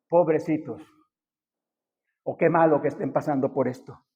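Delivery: dropped low and soft, empty sympathy; hollow pity. Retold or imitated. Pastor mocking empty words to congregation, low hollow pity. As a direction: sigh out a lazy pity. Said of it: "No solamente decir".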